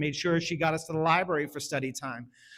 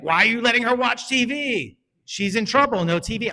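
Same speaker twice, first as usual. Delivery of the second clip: loud, high exasperated scolding; mock scolding wife; 6 semitones higher, 6 dB louder than usual. Wife scolding husband about the TV, loud mock anger. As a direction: scold him as the fed-up wife. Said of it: "my wife's like".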